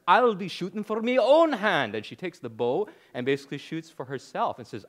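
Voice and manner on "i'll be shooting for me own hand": loud, high Scottish shout; defiant Scottish princess yell; Scottish accent yell. Retold or imitated. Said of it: "she yells in her Scottish accent".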